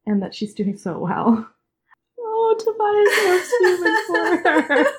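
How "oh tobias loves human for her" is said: high, slow, laughing through tears; crying. Real, not acted. Fan overwhelmed by a scene, laughing and crying. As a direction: voice breaking on the love.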